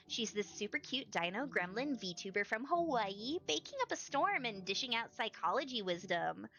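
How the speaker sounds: put-on bubbly Valley Girl voice; gushing Valley Girl read; Valley Girl accent. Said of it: "Do you like my Valley Girl accent?".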